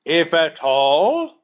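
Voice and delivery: roaring. A narrator's reading. Lieutenant roars back at his ruler in fury. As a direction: bellow the words back in outrage. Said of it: "roared Dak Kova".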